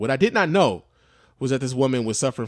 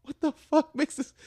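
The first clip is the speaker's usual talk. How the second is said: shocked; 13 semitones higher than usual. Retold or imitated. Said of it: "I'm like".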